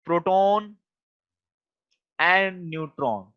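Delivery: word by word, with long pauses; slow dictation. Real, not acted. Teacher writing a note for students, slow dictation. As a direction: dictate slowly so they can write along.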